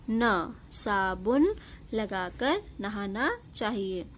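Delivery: slow, clear dictation-style reading. Shown as a demonstration. Teacher reading the finished sentence slowly to pupils. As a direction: read the sentence slowly for children.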